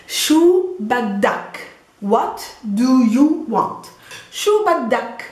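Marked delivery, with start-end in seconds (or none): 0.1-1.6 "Shu baddak" drawn out word by word; slow teaching demo. Shown as a demonstration. Teacher models the new phrase for learners, slow and drawn out. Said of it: "it becomes baddak". 2.0-3.9 "What do you want?" stretched out word by word; slow translation. Shown as a demonstration. Teacher translates the new phrase for learners, slow and deliberate.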